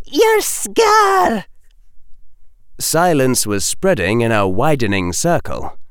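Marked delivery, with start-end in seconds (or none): 0.1-1.4 high-pitched alarmed cry; aghast, high outburst; shocked exclamation. A narrator's reading. Teacher sees the bleeding scar.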